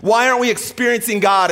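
loud, high-pitched protest; mock congregation grievance; indignant complaint. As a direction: complain loudly from the pews. Acted complaint from the boat.

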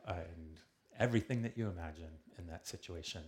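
normal talking voice

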